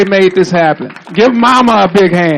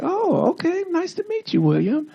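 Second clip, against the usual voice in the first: raised-pitch girlish imitation of her greeting; imitating her; 12 semitones higher, 7 dB quieter than usual. A man retelling meeting his wife, voicing her in a high pitch. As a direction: pitch way up to play the woman he met. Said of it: "she was like".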